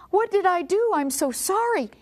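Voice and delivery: high-pitched, alarmed apology; distressed apology. Retold or imitated. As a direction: act out a girl apologising in a fluster. Startled girl apologising to policeman.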